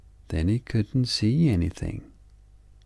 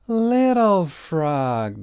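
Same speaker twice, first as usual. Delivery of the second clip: higher, slow, long calling shout; family calling out; 9 semitones higher, 4 dB louder, much slower than usual. A narrator's reading. Family calling from the helicopter, long calling shout. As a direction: call across the snow. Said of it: "they shouted".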